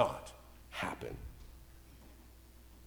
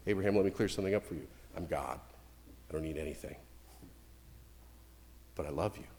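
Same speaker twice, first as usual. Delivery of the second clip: much lower and softer, calm and certain; voice of God; 9 semitones lower, 9 dB quieter, faster than usual. Retold or imitated. God reassuring Abraham of his love, low and calm. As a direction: speak as God, low and unhurried. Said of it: "that's what God was doing".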